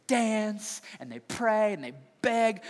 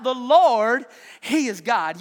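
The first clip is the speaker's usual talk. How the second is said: loud, high outcry; crying out; 4 semitones higher, 9 dB louder, much slower than usual. Retold or imitated. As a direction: cry it out to the crowd. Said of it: "they cried out".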